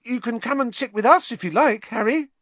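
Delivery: high, fast, eager chatter; eager boy. Acted. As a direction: pester him brightly like a starstruck kid. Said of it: "Colin looked eagerly around".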